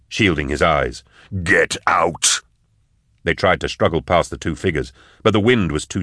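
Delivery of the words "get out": strained, restrained command to flee; urgent order. Acted. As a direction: order them out with force, held in.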